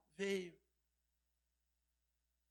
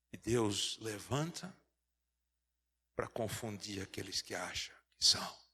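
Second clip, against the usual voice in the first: whispered, lower punchline; hushed aside; 8 semitones lower than usual. Real, not acted. Preacher telling the church about God's chosen, in a whisper. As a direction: drop to a whisper to drive it home.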